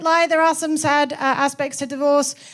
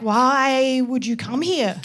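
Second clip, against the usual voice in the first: baffled question; 2 semitones lower, much slower than usual. Retold or imitated. Aussies questioning an emigrant, slow disbelief. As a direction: drag it out in mock bafflement. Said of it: "'cause they're like".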